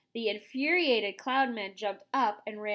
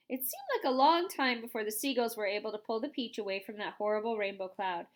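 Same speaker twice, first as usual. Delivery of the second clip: usual speaking voice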